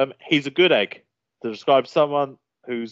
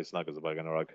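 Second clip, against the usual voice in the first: put-on soft childish saying; 7 semitones lower, 13 dB quieter, faster than usual. Shown as a demonstration. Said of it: "very childish saying".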